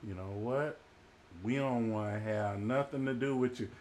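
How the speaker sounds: low, soft brush-off; quietly wash your hands of him; low muttered rejection; sailor disowning Jonah. Acted.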